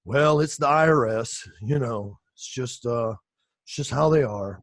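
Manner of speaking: mock monotone accountant; boring competitor. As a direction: drag it out like a bored accountant. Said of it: "my competition sound like this".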